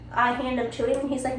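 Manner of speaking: usual speaking voice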